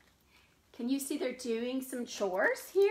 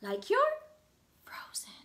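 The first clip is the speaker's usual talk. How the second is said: whispered frozen; 8 semitones higher than usual. Shown as a demonstration. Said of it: "sit real still and freeze".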